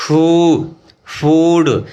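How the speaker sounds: "शू" और "फूड" ज़ोर से, लंबा ऊ खींचकर; "शू", "फूड" — लंबी ऊ की आवाज़ साफ़ और ऊँची बोलें; loud example words. Shown as a demonstration. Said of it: "जिसमें ये साउंड आता है ऊ". (शिक्षक छात्रों को लंबा ऊ सुनाते हुए, ज़ोरदार उच्चारण)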